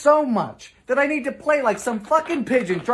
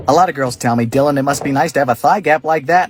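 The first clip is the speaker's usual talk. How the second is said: much lower, loud, swaggering voice; low bragging dude; 8 semitones lower, 4 dB louder than usual. Acted. Bragging to girls about his thigh gap, low and loud. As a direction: boast it from deep in the chest.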